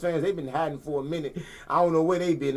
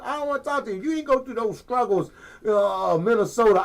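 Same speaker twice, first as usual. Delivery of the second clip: mock scolding; 6 semitones higher than usual. Real, not acted. Playfully scolding bandwagon fans.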